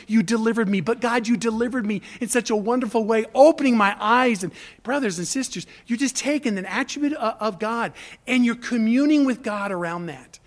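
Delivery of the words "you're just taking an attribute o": ordinary delivery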